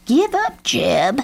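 high-pitched taunt that drops on the name; jeering crow. A narrator's reading. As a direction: call out mockingly as an old crow. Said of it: "jeered Grandfather Crow".

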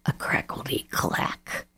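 hushed eerie whisper; spooky whisper. A narrator's reading. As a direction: breathe it like a secret. Spooky sound in story.

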